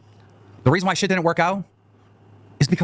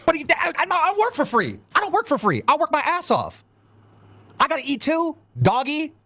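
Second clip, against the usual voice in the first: much higher, rising indignant rant; pissed off; 12 semitones higher than usual. Real, not acted. Streamer ranting to Wings about being unpaid, high indignant voice. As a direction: vent like you've been cheated. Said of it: "I'm just pissed".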